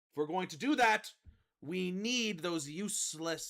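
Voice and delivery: loud, slow, menacing command to her troops; rally the crew with cold contempt; villain commander speech. Acted.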